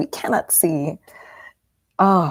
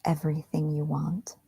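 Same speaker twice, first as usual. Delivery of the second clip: soft, whispery meditation guide; guided meditation; 7 dB quieter than usual. Acted. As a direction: ease them gently back into the room.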